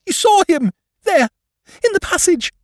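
shrieking high, loud bursts; panicked woman's outcry; terrified cry. Acted. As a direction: cry out in terror, pitch far up. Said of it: "she cried".

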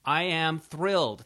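loud, slow reading with heavy weight on "thrilled"; all caps on thrilled. Retold or imitated. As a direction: read it big, as if "thrilled" were in capitals. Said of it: "thrilled in all caps".

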